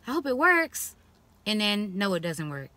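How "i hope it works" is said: pitched well up, hopeful try-it voice; higher, eager hopeful voice; hopeful patient. Retold or imitated. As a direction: sound like you're giving the cure one more hopeful go. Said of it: "you're like".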